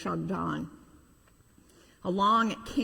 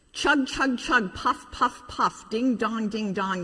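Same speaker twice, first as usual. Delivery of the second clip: chug, puff and ding dong like a toy train; train sound effects; slower than usual. Acted. Preacher voicing the train for the kids, playful chugging.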